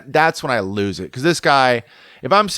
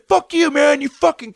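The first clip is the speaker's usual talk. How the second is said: high-pitched mock angry kid; retold cussing, pitched way up. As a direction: imitate a kid hurling abuse. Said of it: "starts cussing me out".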